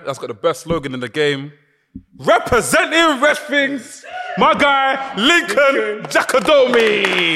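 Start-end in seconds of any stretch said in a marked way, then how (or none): 2.2-7.4 slow booming wrestling announcer; ring announcer. Pretend.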